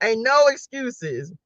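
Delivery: ordinary talk, nothing marked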